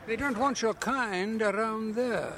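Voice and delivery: mock disapproval. Mock voice of those excluding him.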